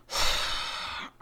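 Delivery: long breathy exhale; weary sigh. Acted. Producer before rolling the track, weary sigh. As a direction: let out a long, resigned sigh. Fed-up producer in recording skit.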